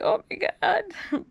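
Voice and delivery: high, drawn-out mock gasp of oh my God; mock teary gasp. Telling friends how she tears up, mock squeal. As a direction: squeal it like you're welling up. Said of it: "I'll tear up and be like".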